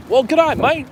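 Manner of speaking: high, loud hammed-up Aussie greeting; over-the-top Australian catchphrase; mock Australian accent. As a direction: belt it out in a cartoon Australian accent. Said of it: "Very Australian".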